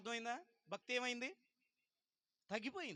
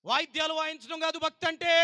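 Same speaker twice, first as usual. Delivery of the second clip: preaching at full force; 8 semitones higher, 11 dB louder than usual. Acted. Preacher thundering at congregation.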